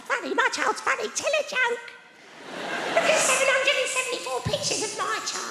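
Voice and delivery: high, put-on voice of a boring parent; high mock-bragging parent voice; mocking a bore. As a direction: send up a parent boasting about their child. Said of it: "He's like".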